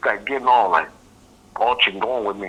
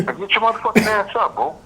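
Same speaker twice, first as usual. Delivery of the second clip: laughing yell; 11 semitones higher than usual. Retold or imitated. Waking him, laughing, escalated.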